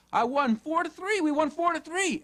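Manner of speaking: put-on high, bubbly child bringing good news; excited daughter. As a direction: play a thrilled kid after the game. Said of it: "she's all excited".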